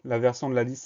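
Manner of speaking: ordinary delivery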